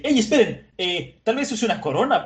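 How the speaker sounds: a high, startled call to wait; surprised protest. Acted. Mr. Nine stopping the others, high startled protest. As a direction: cut in like a rattled henchman.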